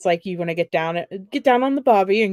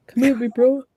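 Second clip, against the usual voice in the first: mock tough guy; 5 semitones higher, 4 dB louder than usual.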